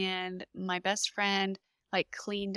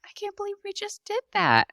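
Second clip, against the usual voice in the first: much higher, bursting with amazement; elated disbelief; 13 semitones higher, faster than usual. Retold or imitated. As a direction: let the joy spill over, pitched up high. Elated disbelief after birth.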